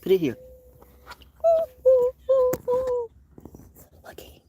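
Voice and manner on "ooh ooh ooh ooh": high, loud, delighted oohs; excited oohs. Real, not acted. Seeing the bird close, excited high loud oohs.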